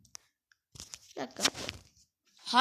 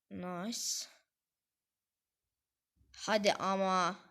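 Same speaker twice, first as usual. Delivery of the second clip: usual speaking voice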